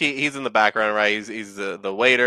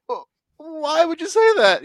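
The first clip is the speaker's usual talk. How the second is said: very high, mock-wounded whine; hammed-up high plaintive protest; hurt kid. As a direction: imitate a wounded boy, voice pitched way up. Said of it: "he's just like".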